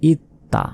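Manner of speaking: ইত তা as it-ta, t held then released; doubled consonant. Shown as a demonstration. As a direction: close on it, then sound ta in ইত তা. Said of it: "দুইবার উচ্চারণ হবে". (ইত তা, ত দুইবার)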